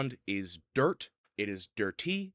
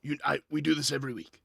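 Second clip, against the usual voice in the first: put-on bruiser voice, growing louder and higher; indignant regular at the gate; armored giant Glurg; 4 semitones higher, 3 dB louder, faster than usual. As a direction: grumble while searching, then protest. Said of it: "Glurg fiddles about in a pocket".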